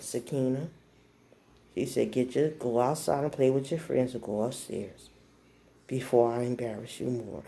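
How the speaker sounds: put-on underplay it like an unbothered old-school parent; real calm. Mom calmly sends daughter outside with a threat, real calm. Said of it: "be real calm".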